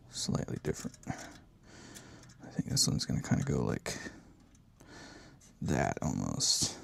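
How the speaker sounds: low slow whisper. Real, not acted. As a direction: whisper it under breath. Voiced part drops to whisper.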